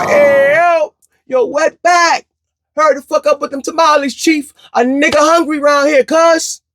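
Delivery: very high, loud mock street call; mock hollering. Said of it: "you hollered back".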